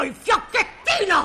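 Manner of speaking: high-pitched enraged threat; furious threat. Acted. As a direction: throw the word back angrily, high. Shopkeeper threatens to beat the boy.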